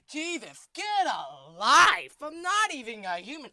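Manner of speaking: exasperated shout. Acted. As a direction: roll your eyes and shout it. Pumpkin rants at his captor.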